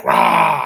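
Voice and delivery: sudden loud unvoiced rah; loud mock growl from a bush; mock scare roar. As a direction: jump out and roar to scare the kids. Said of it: "I'd be like".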